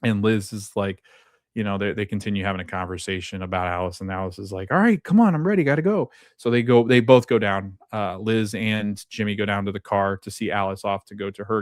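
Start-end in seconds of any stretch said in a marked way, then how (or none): 4.7-6.1 mock eager hurry. Alice eager to leave.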